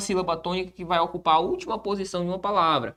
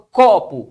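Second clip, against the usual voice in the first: "copo" with a loud first syllable; stressed first syllable; 7 dB louder than usual. Shown as a demonstration. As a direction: punch the "co" in "copo", let "po" drop. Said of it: "esse có é mais forte do que o pô".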